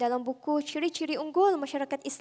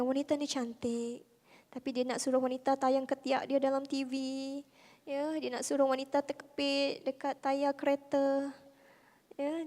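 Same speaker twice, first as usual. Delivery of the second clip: normal talking voice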